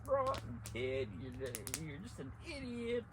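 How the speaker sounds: high mock angry voice; hammed-up scolding father; the father scolding his son as an idiot; angry dad. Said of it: "He was like".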